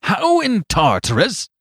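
loud, high outburst; astonished cry. Acted. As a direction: cry out in disbelief. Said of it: "cried Flambeau".

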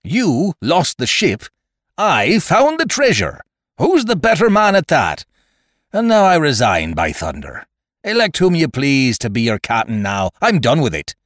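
loud, high pirate outburst, easing as he quits; proud pirate leader in a huff; indignant resignation. Acted. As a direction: throw the command back in their faces. Silver boasts and angrily resigns.

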